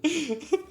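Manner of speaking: quick high giggle. Real, not acted. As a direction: giggle at your own joke. Laughs at his own bit.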